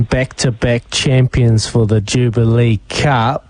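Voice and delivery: ordinary talk, nothing marked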